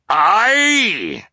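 high, loud sea-captain bark; shouted aye. A narrator's reading. Boisterous captain shouting aye at the dinner table. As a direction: blast out the aye like a hearty captain. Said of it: "Captain Widdershins shouted".

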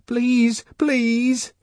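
put-on high-pitched child's begging; crying plea. A narrator's reading. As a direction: plead tearfully in a small high voice.

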